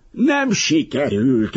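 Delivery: high, drawn-out raving cry. A narrator's reading. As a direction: rave it slowly, pitch right up. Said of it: "őrjöngött az Alabarkosz". (őrjöngő, elnyújtott, magas kiáltás)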